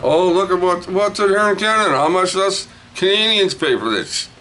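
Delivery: very high, exclaiming sales patter; high mock-amazed question; showy excitement. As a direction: pitch it way up as if amazed.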